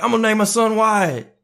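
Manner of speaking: high, loud proud declaration; excited announcement. Retold or imitated. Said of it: "So I was like".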